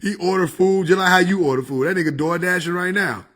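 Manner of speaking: normal talking voice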